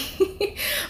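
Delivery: short, high, breathy chuckle; light chuckle. Real, not acted. Speaker breaking off the exhortation for a moment, light chuckle. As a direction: let out a brief little laugh. Brief laugh between exhortations.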